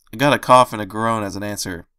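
usual speaking voice

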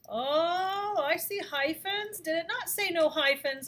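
hammed-up teacherly surprise; mock surprise. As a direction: act like you just spotted a mistake. Teacher feigns discovering hyphens.

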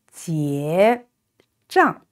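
up on 结, down on 账; rising then falling. Shown as a demonstration. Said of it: "Can you try with me?".

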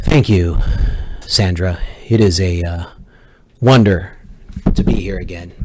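loud, punchy mock ASMR; aggressive ASMR. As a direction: shout the ASMR greeting. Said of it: "aggressive A S M R".

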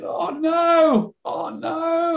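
high, drawn-out wail of despair; retold crying. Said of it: "he started crying uncontrollably".

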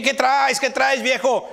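high-pitched mock scold of a quick-tempered wife; imitating a wife challenging her old man, pitched high; feisty wife. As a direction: play the wife who won't back down, snapping at her husband. Preacher voices a feisty wife.

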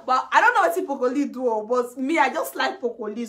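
ordinary delivery

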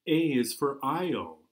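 plain reading voice

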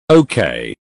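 usual speaking voice

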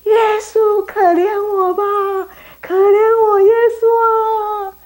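very high, loud, stretched plea; loud desperate cry. Acted. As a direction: beg at the top of your voice. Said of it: "他叫得好大声".

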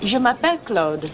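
"Je m'appelle Claude" said the French way; French pronunciation. Shown as a demonstration. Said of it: "just repeat after me".

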